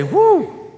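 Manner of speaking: high-pitched "whoo"; acted gasp of being overwhelmed; awed whoop. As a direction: let out a high whoo of shock. Acting awe entering the clean room.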